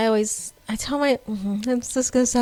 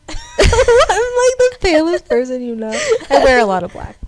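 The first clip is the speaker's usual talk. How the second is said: higher, louder laughing talk; 7 semitones higher, 7 dB louder than usual. Real, not acted. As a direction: laugh through the words. Laughing self-description.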